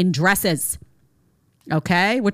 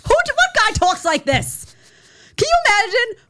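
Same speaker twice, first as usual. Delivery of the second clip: incredulous; 12 semitones higher, 3 dB louder than usual. Real, not acted. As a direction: stretch it out in shock. Mocking disbelief.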